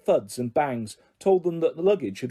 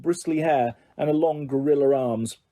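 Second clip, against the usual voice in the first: usual speaking voice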